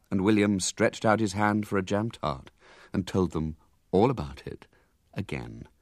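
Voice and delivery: plain reading voice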